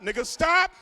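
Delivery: high, loud, rising at the end; exasperated plea. Acted. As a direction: push it up into a loud, incredulous plea. Escalating repeated plea to stop.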